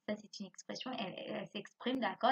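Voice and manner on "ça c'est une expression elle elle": usual speaking voice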